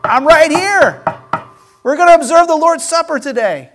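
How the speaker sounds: excited, open-armed announcement; eager invitation. Retold or imitated. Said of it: "So Jesus is like".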